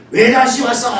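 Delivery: retold angry shout. Husband to returning wife, still angry, mildly imitated shout. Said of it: "화가 덜 풀린 남편이 막 소리를".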